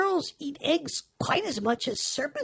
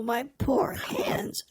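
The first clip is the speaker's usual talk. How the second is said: whisper; much slower than usual. Acted. Pitying her lost hands.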